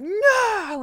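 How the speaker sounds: high loud yell at the cat; sharp shout. Retold or imitated. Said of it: "And so I was like".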